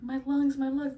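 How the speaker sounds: high, loud panicked cry. Retold or imitated. Patient feeling baby pulled out, panicked cry. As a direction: gasp it out in alarm. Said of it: "and I was like".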